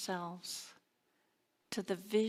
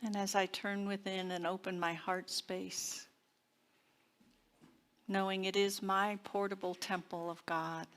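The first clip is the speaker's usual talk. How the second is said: affirmative prayer. Acted. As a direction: lead the congregation inward, gently and unhurried. Leads a spoken affirmative prayer.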